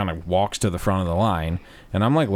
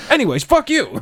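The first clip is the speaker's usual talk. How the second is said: loud, high burst of mock fury; retold angry shout; 6 dB louder than usual. Storyteller resuming the fight with the lady, loud comic outrage. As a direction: snap straight back into yelling.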